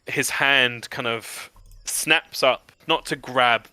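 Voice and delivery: ordinary talk, nothing marked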